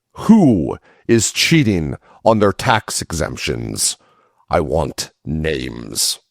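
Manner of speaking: put-on a stern French minister demanding data, in a weak French accent; barely-there French accent, voiced low and deliberate; Colbert's terrible French accent. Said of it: "terrible accent as I play Jean-Baptiste".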